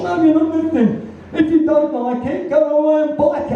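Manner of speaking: high put-on producer voice snapping back; quoted producer. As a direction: imitate the producer's huffy comeback. Producer's quoted angry retort.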